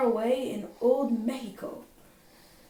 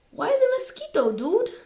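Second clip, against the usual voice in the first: much higher, tiny-dog voice; squeaky little dog; 11 semitones higher, 5 dB louder than usual. Acted. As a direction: play the smallest one, piping up. Smallest Chihuahua asks.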